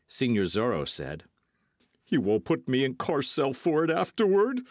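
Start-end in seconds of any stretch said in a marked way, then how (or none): 2.1-4.6 high, plaintive wail; a mild wail of protest. A narrator's reading. The landlord to Zorro, pleading in a high wail. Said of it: "the landlord wailed".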